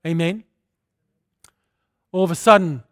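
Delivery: ordinary talk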